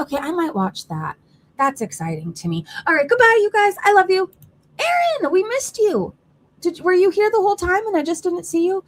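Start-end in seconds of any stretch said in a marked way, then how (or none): 2.8-8.8 gushy goodbye. Real, not acted. Cutesy sign-off to chat.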